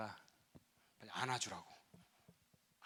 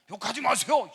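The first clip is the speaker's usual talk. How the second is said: sudden high yell of protest; angry shout; 10 semitones higher, 14 dB louder than usual. Retold or imitated. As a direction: snap back loudly at the pastor. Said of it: "신랑이 또 이런다". (신랑이 목사에게 버럭 소리치며 대드는)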